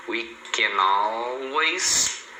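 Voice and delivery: CAN ALWAYS joined as "ca-nalways"; very slow demo, gliding the N of "can" into "always"; linked words. Shown as a demonstration. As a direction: stretch "can always" out as one unbroken word. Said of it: "você fala tudo junto".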